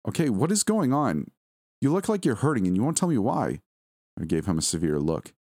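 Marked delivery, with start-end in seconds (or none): 0.1-3.6 higher, urgent demand for answers; exasperated, pleading insistence; worried confrontation. Acted. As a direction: press a loved one for the truth, pitch swooping up. Wife demands an explanation from husband.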